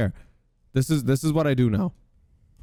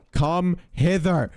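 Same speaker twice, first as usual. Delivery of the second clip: mock formal; 5 semitones higher, much slower than usual. Real, not acted. Calling the baby ghast.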